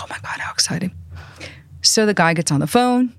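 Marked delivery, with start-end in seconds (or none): 0.0-0.9 soft, breathless thrilled whisper; excited whisper. Retold or imitated. Young publicist getting a Rolling Stone call, excited whisper. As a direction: whisper it like you can't believe it.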